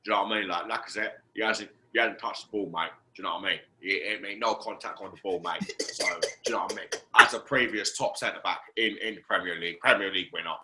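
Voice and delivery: a touch of a former centre back giving his verdict; Rio Ferdinand impression. Fan lightly mimicking Rio Ferdinand's pundit talk. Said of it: "Rio, Rio".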